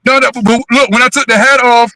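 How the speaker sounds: ordinary talk, nothing marked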